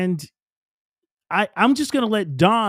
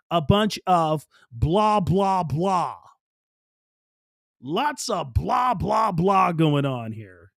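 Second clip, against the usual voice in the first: drawn-out mocking blah; slower than usual. Mocking fundraiser pitches.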